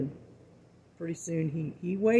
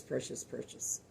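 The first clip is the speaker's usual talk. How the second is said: "precious, precious" whispered, fading to a hush; 4 semitones lower, 14 dB quieter than usual. Real, not acted. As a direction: let the repeated "precious" die away in a whisper. Repeats word, then whispers it.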